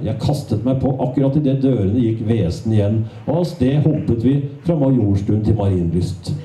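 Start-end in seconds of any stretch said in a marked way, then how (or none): none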